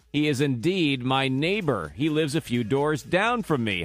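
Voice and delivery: ordinary talk, nothing marked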